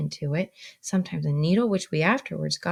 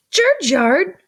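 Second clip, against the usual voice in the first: high, louder sharp question; indignant repeat; 11 semitones higher, 7 dB louder than usual. Acted. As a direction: fling the word back at him in disbelief. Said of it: "repeated my sister".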